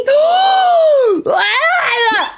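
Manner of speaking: a sharp, high gasp of fright; startled gasp. Real, not acted. Streamer gasps when a friend suddenly appears.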